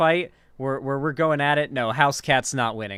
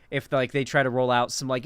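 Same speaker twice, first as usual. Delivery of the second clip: ordinary talk, nothing marked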